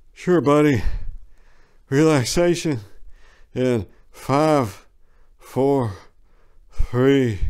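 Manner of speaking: lightly voiced calm countdown technician; a hint of a medic easing a patient under; soothing technician. A narrator's reading.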